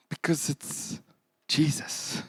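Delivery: hushed whisper of the name. Real, not acted. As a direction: let the answer fall to a whisper. Preacher whispers the answer.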